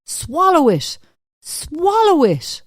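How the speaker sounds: high, forceful, drawn-out order; shouted command. A narrator's reading. Husband forcing bread on his wife, shouting. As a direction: shout it as a fierce command, pitch leaping up.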